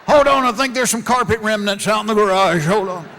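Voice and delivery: loud, rushed; hurried friend. Retold or imitated. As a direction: call back in a hurry.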